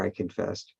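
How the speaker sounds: normal talking voice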